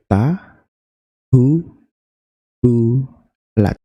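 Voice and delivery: very slow "ta, hu, bu, lat" with long gaps; syllable by syllable. Shown as a demonstration. Teacher splitting a word for learners, syllable by syllable. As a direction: break "tahu bulat" into four separate beats. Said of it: "suku kata itu adalah penggalan kata".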